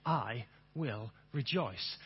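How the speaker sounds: low, soft and slow; a quiet voice in the back of the head; preacher's gentle reminder whispered in the mind; soft inner voice. Shown as a demonstration. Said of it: "you'll hear my voice saying".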